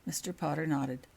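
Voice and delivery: usual speaking voice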